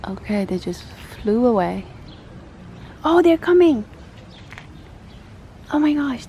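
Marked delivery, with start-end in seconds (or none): 3.0-6.2 high, loud thrilled exclaim; excited. Real, not acted. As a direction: burst out as the birds come. Birds finally approach.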